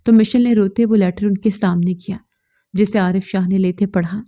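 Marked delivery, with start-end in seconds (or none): none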